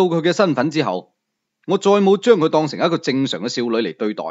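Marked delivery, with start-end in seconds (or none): none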